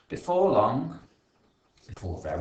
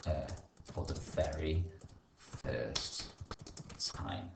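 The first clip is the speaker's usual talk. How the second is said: hushed ending; 5 semitones lower, 8 dB quieter, slower than usual. A narrator's reading. Dramatic storytelling close.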